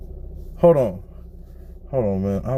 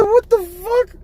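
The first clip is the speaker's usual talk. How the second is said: much higher, cracking with laughter; shocked; slower than usual. Retold or imitated. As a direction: screech it in shocked disbelief. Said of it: "I said".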